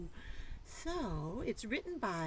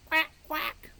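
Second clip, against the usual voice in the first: high, loud quacking; duck quack; 7 semitones higher, 10 dB louder than usual. Acted. Duck sound in clue.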